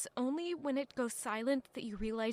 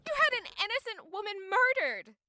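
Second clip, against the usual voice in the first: high, loud outraged cry; furious accusation; 9 semitones higher, 5 dB louder than usual. Acted. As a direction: throw the accusation at him. Heated quarrel with the villain.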